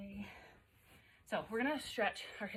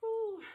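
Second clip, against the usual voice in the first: loud high whoop on the press; effortful whoo; 12 semitones higher, 7 dB louder than usual. Real, not acted. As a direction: blow out a big high "whoo". Exhaling hard with each press.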